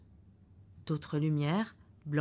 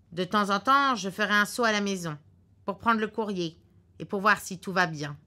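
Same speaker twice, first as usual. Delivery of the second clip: the husband's voice, louder and a bit higher, certain of himself; gruff husband; 4 semitones higher, 7 dB louder than usual. Acted. Husband in the car predicting a cancelled flight, curtly sure. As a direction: lay out the bad news like a know-it-all husband.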